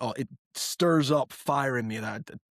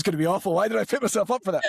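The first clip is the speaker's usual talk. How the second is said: nervous laugh; 7 semitones higher, 4 dB louder, faster than usual. Real, not acted. Nervous before doing accent.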